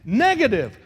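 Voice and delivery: preacher giving the church a prayer to say; model prayer. Acted. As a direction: pray it out for them.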